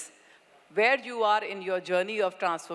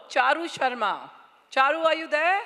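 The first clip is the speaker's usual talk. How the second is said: calling out; 7 semitones higher, 5 dB louder than usual. Real, not acted. Calling a winner from stage.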